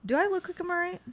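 high, mock-offended retort; playfully indignant; sarcastic. Real, not acted. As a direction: toss it back sarcastically. Sarcastic reply to are you okay.

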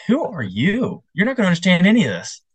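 high-pitched mock disbelief. Opera-goer doubting a young kid, mock scoffing.

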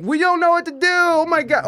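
high, frantic mock panic; panicked locals. Mocking snow-panicked locals, high and frantic. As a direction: squeal it like helpless snow-struck locals.

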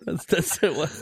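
high shrieking laugh. Real, not acted. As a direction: lose it laughing. Bursts out laughing.

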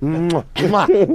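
big loud "mwah" kisses; kiss sound. Real, not acted. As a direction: smack out two exaggerated kisses. Blowing kisses during hugging talk.

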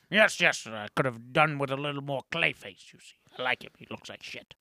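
Baron. Pretend. Answering the host's question on the story, put-on Baron voice. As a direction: play an opinionated critic in an altered voice. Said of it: "What did you think, Baron?".

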